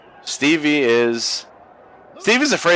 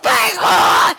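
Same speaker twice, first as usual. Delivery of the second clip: mock shriek; 9 semitones higher, 4 dB louder than usual. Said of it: "I don't know why I sound like a smoker".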